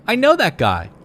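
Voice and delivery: high, bright call of recognition; eager acquaintance greeting. Retold or imitated. Acquaintance spotting him across the store, calling out high. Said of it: "and he goes".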